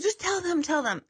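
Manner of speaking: lightly voiced mom pushing her daughter; mom urging. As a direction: nudge her on like an eager mom. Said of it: "And she's like".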